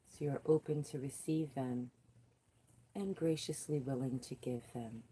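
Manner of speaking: soft, hushed meditation voice; soft guided meditation voice. Acted. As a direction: ease them gently through the grounding.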